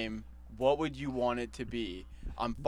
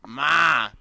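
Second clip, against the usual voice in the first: loud Bronx \"Ma\"; Bronx accent; 3 semitones higher, 9 dB louder than usual. Retold or imitated. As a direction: call out like a Bronx mom's kid. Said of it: "Hey, Ma".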